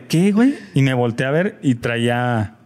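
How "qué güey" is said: high, rising retold disbelief; lightly acted startled query; startled question. Injured rider answering his shocked friend, startled. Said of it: "fue como de".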